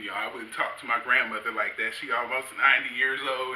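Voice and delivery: usual speaking voice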